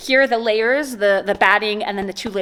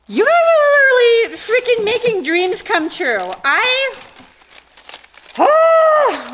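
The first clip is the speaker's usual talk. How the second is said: emotional; 11 semitones higher, 4 dB louder than usual. Real, not acted. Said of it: "making me emotional".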